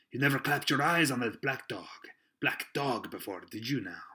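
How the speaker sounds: stern questioning, loud then easing. A narrator's reading. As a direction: interrogate him like a stern ship's officer. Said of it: "said Long John very sternly".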